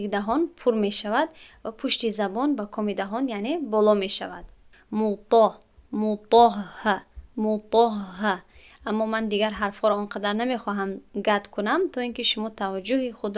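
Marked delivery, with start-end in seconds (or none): 4.9-8.4 slow موطا with a thick emphatic ط; drawn out heavy موطاه; full-mouthed ط in موطا. Shown as a demonstration. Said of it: "تا باید با پرگی تلفظ شود".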